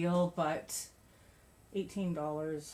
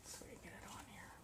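quick whisper; 21 dB quieter, faster than usual. Real, not acted. Putting on the piece.

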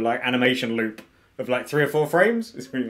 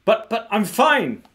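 put-on higher, slow child's protest; 11 semitones higher, 5 dB louder, slower than usual. Sammy objects to being protected, high child's protest. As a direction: stammer a protest in a raised little voice.

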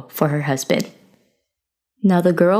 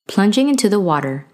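ordinary delivery, nothing marked

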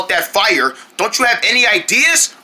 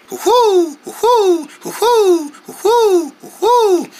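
high, falling owl hoots; repeated swooping hoo-hoos; owl hooting; 12 semitones higher, slower than usual. Acted.

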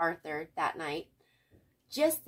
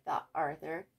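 ordinary delivery, nothing marked

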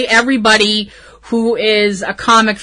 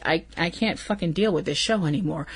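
soft, fast, defeated mutter under the breath; overwhelmed aside; 4 semitones lower, 12 dB quieter, faster than usual. Retold or imitated. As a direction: throw it away quietly, beaten by the show. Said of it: "and I'm just like".

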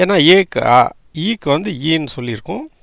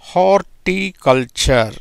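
Horticulture said slowly, syllables spread out; slow demo; 3 semitones higher, much slower than usual. Shown as a demonstration. Teacher modelling the word for students, slow and careful. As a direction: draw out horticulture for the class. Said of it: "எப்படி பாருது பாருங்க".

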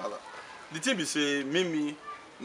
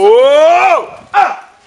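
loud high startled cry; shocked cry; 9 semitones higher, 16 dB louder than usual. Acted. Caught off guard in the house, loud shocked cry.

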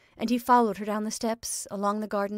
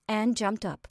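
ordinary delivery, nothing marked

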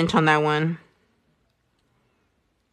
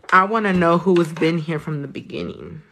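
ordinary talk

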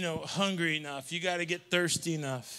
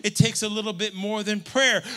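ordinary talk, nothing marked

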